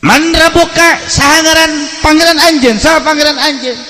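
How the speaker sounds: high, loud, fast demanding questions; stern angel interrogating. Acted. Angel Munkar questions the dead man in his grave, high, loud and fast.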